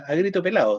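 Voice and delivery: "a grito pelado" said as pelao, D swallowed; dropped D. Shown as a demonstration. Teacher showing Chilean speech, "a grito pelado" with no D. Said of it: "nos comemos la D intervocálica".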